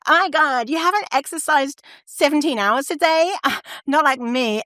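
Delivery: mock shock, pitched high; judgmental boasting. Former self judging someone's exercise, mock shock.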